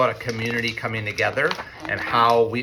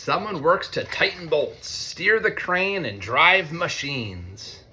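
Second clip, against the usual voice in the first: storybook rhyme, pitched higher and brighter; reading to children; 5 semitones higher than usual. A narrator's reading. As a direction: lift the rhymes for a young audience. Reads picture book to students.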